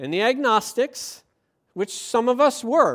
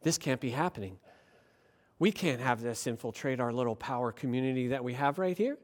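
low, hushed mock worry; worried elites; 10 semitones lower, 11 dB quieter, faster than usual. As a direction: confer anxiously under your breath, as a parody. The elites get upset and they get worried.

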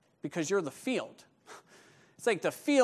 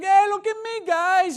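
high, loud excited crowing; hammed-up giddy excitement; all excited; 13 semitones higher, 9 dB louder than usual. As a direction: show off to the guys at full pitch. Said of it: "Joey's all excited".

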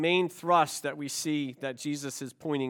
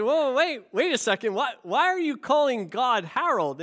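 higher-pitched startled interruption; bursting in; 8 semitones higher, 5 dB louder than usual. Retold or imitated. Father interrupting his child's prayer, startled and bursting in. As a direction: cut in like a baffled father. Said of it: "father automatically bursts in".

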